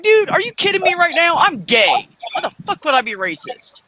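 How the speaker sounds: fast indignant disbelief; indignant outburst. Retold or imitated. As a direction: fire back, stunned and offended. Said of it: "I was like".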